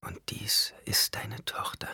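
slow, hushed whisper. A narrator's reading. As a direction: whisper tenderly over the cradle. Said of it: "wisperte Hara".

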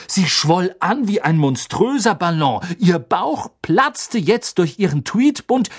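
ordinary delivery, nothing marked

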